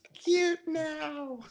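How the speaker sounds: very high, slow, cutesy little voice; high-pitched playful baby voice. Pretend. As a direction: coo it high like an adorable tiny one. Voicing the adorable baby in picture.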